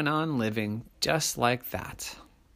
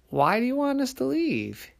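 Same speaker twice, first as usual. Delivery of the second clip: high-pitched small person's question; tiny little person; 13 semitones higher than usual. A narrator's reading. Little person questioning the monsters, small high voice.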